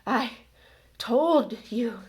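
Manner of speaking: winded, with a gap between words; breathless, halting reproach; gasped. A narrator's reading. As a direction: push each word out on a gasp. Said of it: "Hermione gasped, clutching at the stitch".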